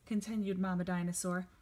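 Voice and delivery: usual speaking voice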